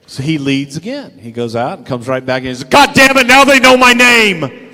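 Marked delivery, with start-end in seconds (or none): 2.6-4.7 high, loud alarmed yell; panicked shout. Retold or imitated. Friend thinks his hallucinations know his name, panicked shout.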